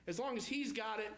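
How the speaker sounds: ordinary delivery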